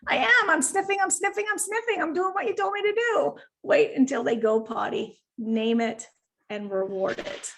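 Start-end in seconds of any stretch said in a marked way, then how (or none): 0.1-3.3 much higher, earnest puppy protesting. Retold or imitated. Puppy answering owner at night, high and earnest. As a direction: play the puppy pleading it obeys. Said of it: "And they're like".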